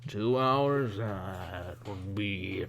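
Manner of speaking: lightly tipsy boatman working out a price; a hint of boozy pondering; drunk middle-aged man. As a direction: reckon it up as a mild drunk. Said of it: "drunk middle-aged man".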